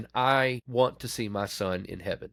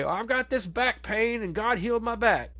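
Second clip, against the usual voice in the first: high, mocking voice of a self-satisfied believer; mocking whiny churchgoer; 11 semitones higher than usual. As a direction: sneer it like someone bragging about a tiny blessing. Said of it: "people who say".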